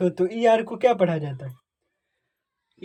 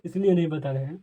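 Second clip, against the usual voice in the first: usual speaking voice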